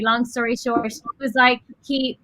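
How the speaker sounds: ordinary talk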